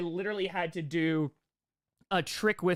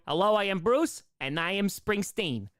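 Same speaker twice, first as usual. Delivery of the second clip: normal talking voice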